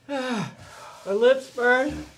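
usual speaking voice

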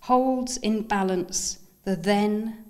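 lifted, deliberate recital, pausing after each image; measured poem voice, each phrase set apart; poetry reading. Acted. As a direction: recite it line by line to an audience. Reads second poem aloud.

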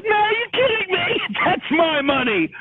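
high, indignant protest with a laugh. Retold or imitated. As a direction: act outraged, half laughing. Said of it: "I said".

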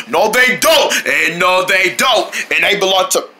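ordinary talk, nothing marked

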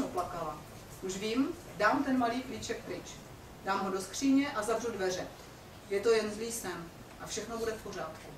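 telling a dark tale to students, hushed; story narration. A narrator's reading. Teacher reading Bluebeard to the class, hushed narration.

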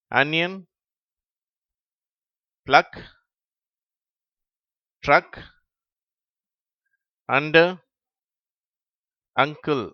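brisk list reading, rising on each word; reading word list quickly. Shown as a demonstration. Teacher reading English words to pupils without pauses. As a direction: read the words straight through. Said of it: "இடைவெளி விட்டு நான் வாசிக்கிறேன்".

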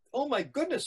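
high-pitched gasping exclamation; storyteller voicing a concerned nurse; shocked nurse. Nurse reacts to girl in shock.

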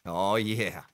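ordinary talk

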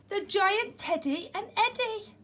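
plain reading voice

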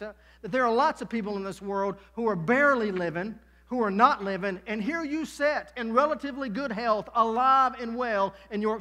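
loud, high, driving preacher voice; fiery preaching. Acted. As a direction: preach it at full force to the congregation. Preaching at full force.

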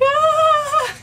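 very high, loud cry of joy; joyful shriek. Retold or imitated. Mother hearing her newborn cry, high joyful shriek. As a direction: burst out in a shriek of relief.

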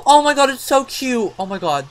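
high, loud gushing; delighted squeal. Real, not acted. Player sees the new cat, squealing in delight. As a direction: squeal over how adorable it is.

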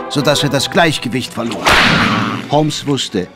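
ordinary delivery, nothing marked

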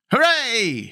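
high, mild cheering shriek; delighted shriek. A narrator's reading. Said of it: "shrieked the rebels".

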